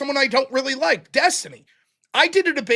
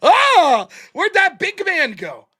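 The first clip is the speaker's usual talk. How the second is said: mock taunt; 5 semitones higher, 7 dB louder, much slower than usual. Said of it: "mocking the shit talker".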